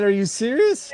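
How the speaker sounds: high, rising incredulous question; retold amused disbelief. Friend retells answering the Santa guess, amused disbelief.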